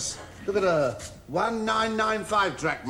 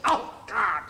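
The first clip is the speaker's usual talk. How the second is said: usual speaking voice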